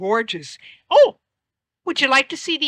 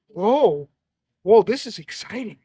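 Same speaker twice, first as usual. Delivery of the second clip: usual speaking voice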